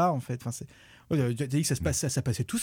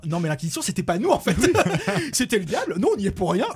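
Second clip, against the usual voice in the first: higher, louder mock protest with laughter breaking in; Church making excuses; 6 semitones higher, 4 dB louder than usual. Critic voicing the Church dodging blame, mock-innocent. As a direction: plead innocence in a hammed-up voice. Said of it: "l'église est en train de dire".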